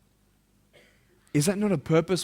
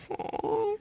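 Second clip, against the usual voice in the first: touched aw; 8 dB quieter than usual. Retold or imitated. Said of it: "And I'm like".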